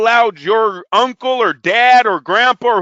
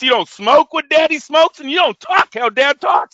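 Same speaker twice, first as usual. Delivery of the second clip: higher, louder mock lecture to a kid; mock stern dad; 6 semitones higher, 3 dB louder than usual. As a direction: scold like a hypocritical father. Dad mock-lecturing his son.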